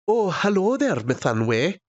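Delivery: lilting mock Welsh, starting high and dropping; botched Welsh accent. Shown as a demonstration. Irishman attempting Welsh for his friends, playful rough Welsh accent. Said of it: "I can't do a Welsh accent".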